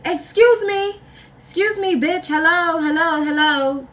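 high-pitched, insistent heckling; mock confrontational callout. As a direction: imitate her demanding attention, voice way up. Girlfriend calling after the group, retold.

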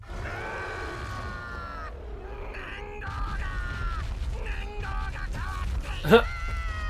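high shrieking cackle; shrieking laugh. Real, not acted. Boss reveal, loud laugh.

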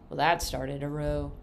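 ordinary delivery, nothing marked

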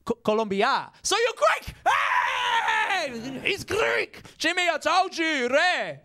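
very high, yelling mock Greek bro; excited Greek dude. Greek dude shouting to Jimmy across the room, hammed-up high yell. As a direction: shout it up high to your mate. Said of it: "They're like".

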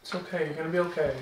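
soft, reassuring voice; calm them gently; soothing. Real, not acted. Calming choking partner.

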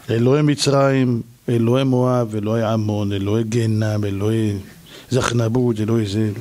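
low, monotone reading of names; droning list. Acted. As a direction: drone through the list like a phone book. Pharaoh reads list of gods.

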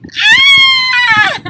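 high playful mock scream; pretend scream. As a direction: shriek as if a crocodile appeared. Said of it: "don't forget to scream".

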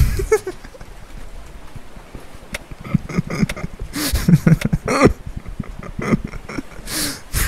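long high wheezing laugh; breathless laugh. Real, not acted. After something went wrong.

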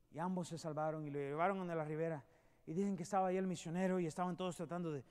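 soft, low storytelling voice; a preacher's hushed parable; hushed sermon story. A narrator's reading. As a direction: draw them in quietly.

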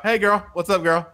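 light, bright high greeting; casual sing-song hey girl; friendly casual greeting. Retold or imitated. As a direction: greet her like a buddy. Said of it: "You know, like that".

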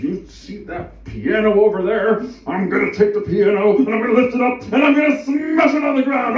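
high shouted threat, building in force; hammed-up furious yelling; raging bear threat. Bear threatens to smash piano.

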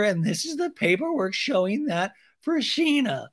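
high, prim rehearsed statement; lightly voiced prim young woman; prim interview voice. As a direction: recite it like a prepared apology.

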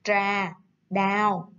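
"trà đào" drawn out syllable by syllable; slow model word. Shown as a demonstration. Teacher modelling "trà đào" slowly for young pupils. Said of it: "Cô có từ".

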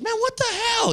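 high-pitched retold protest; mock disbelief. [name] to comic left behind, high mock disbelief.

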